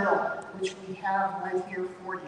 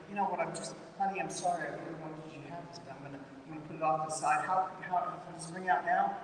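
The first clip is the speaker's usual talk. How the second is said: much softer and hurried, an apologetic murmur; soft apologetic mother; 4 semitones lower, 8 dB quieter, faster than usual. Retold or imitated. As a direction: rush the sorry to the little girl. Mother apologising to her daughter.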